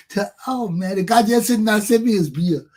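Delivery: normal talking voice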